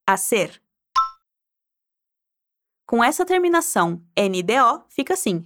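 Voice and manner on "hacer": Hacer said with no h sound, as a-SER; Spanish hacer. Shown as a demonstration. Teacher models the Spanish verb for repeating, clearly.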